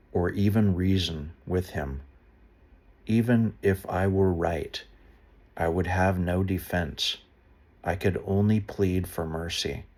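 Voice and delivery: measured, earnest Bible reading; a solemn read of Job, lightly heightened; scripture reading. Acted. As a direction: read the passage out to listeners. Scripture reading resumes after an aside.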